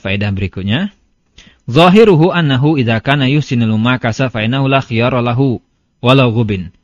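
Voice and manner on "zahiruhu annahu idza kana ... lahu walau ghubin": a touch louder, measured reading of the Arabic; reading Arabic text. Acted. Teacher reading the Arabic source to students, measured recitation.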